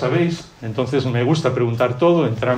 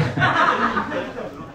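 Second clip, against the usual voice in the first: laugh; 13 semitones higher, 4 dB quieter than usual. Real, not acted. Risa tras recordar vacaciones.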